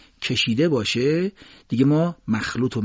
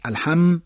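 الحم punched higher with the closing m held; stress on m; 5 semitones higher, 3 dB louder, slower than usual. Shown as a demonstration. Teacher showing where to lean, stressed m. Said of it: "تکیه ایجاد بکنید".

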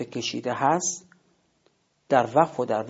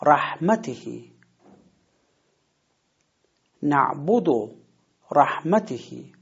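رحمتهی stressed on the میم, نعبدو stressed on the با, drawn out; model stress, slow; 3 semitones higher, 4 dB louder, slower than usual. Shown as a demonstration. Teacher modeling the stressed syllables for students. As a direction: give each word slowly with the marked stress. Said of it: "موضع تکیهٔ ما هست".

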